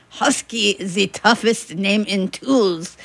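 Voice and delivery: slow, heavy German accent, lightly imitated; a hint of a German accent, slowed down; thick German accent. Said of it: "in his thick German accent".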